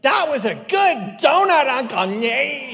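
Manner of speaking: put-on high, slow, loud little-girl voice; three-year-old niece. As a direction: squeal it like a delighted toddler. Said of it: "she's like".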